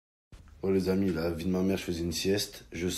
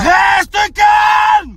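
very high, loud yell; drawn-out high-pitched bellow; angry shout; 12 semitones higher, 5 dB louder than usual. Acted. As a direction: scream for calm at the top of your voice. Shouts calm in quarrel.

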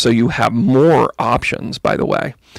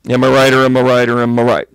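ordinary talk

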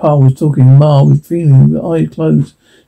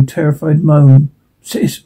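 usual speaking voice

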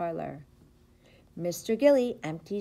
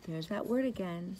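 ordinary delivery, nothing marked

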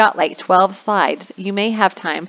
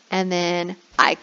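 ordinary talk, nothing marked

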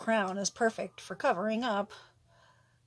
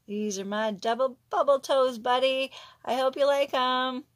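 calling to her son; 3 semitones higher, 7 dB louder than usual. Real, not acted. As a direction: call it out proudly to your son. Showing her son the toes.

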